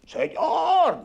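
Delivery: high call that only seems shouted; fake radio shout. Shown as a demonstration. Teacher demos calling out without really shouting. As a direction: sound like a yell while keeping it in check. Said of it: "no gritas, parece que gritas".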